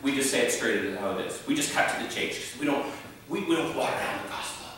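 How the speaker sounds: mock charismatic bragging; charismatic pastor. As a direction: talk straight like a no-nonsense preacher.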